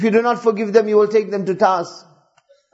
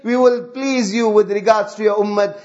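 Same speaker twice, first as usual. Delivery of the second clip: usual speaking voice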